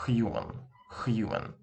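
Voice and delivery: "human" in a heavy Russian accent, rasped kh at the start. Shown as a demonstration. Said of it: "with a Russian accent, it would be".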